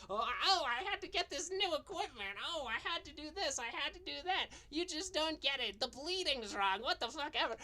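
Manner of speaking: high, whiny mock voice of the creator making excuses; sneering high impression of a complaining creator; mocking whiny Vito. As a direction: pitch it up and whine like he's full of excuses.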